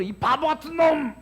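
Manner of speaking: high, loud scolding shout; mock insult. Shown as a demonstration. Lecturer insulting the water to show the crystals, shouting. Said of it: "하니까 이렇게 촬영이 돼버려요".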